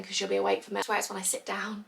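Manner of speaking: usual speaking voice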